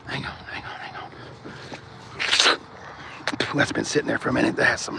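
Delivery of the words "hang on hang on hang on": whispered hang on. Real, not acted. Pausing the stream to drink, whispered. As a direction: breathe it under your breath. Sipping something stale, muttering.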